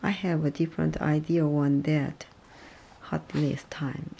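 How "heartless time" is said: breathless whisper, slow and soft. Shown as a demonstration. A learner repeating the phrase under his breath, whispered. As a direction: whisper it to yourself like a private aside.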